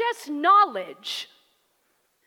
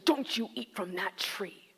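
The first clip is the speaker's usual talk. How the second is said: harsh God voice; 8 semitones lower, 8 dB quieter than usual. Shown as a demonstration. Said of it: "If you hear him in this very sermon".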